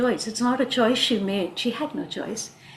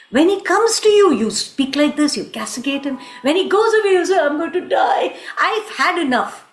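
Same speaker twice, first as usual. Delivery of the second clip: loud, fed-up friend telling her off; exasperated scolding; 3 semitones higher, 6 dB louder than usual. Acted. Friend tells Radha she has had enough, exasperated. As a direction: scold her with rising, insistent force.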